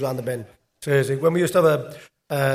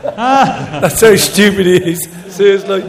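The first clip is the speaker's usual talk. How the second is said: loud, slow, laughing punchline; laughing hard; 5 semitones higher, 10 dB louder, slower than usual. Real, not acted. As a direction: crack up loudly while driving the point home. Laughing at his own punchline.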